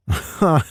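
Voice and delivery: a quick amused snort of a laugh; short snorting laugh. Acted. Miles snorting a laugh at Tanner.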